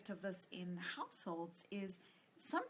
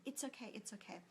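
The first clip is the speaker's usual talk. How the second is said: quiet soothing; 11 dB quieter than usual. Retold or imitated. Mother soothing crying baby.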